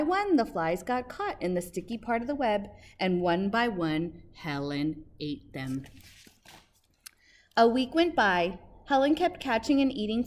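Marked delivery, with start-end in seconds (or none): none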